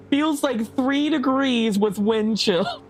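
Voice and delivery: ordinary talk, nothing marked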